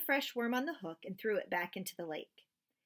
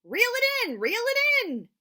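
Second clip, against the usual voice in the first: high, loud urgent yell; dad yelling at son to reel in; 11 dB louder, slower than usual. Acted. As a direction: shout it out to the boy. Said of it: "my dad yelled".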